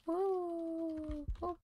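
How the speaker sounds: high, drawn-out "woo"; celebratory woo. Real, not acted. Player pleased at own skill in the game, celebratory woo. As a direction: let out a long, high cheer.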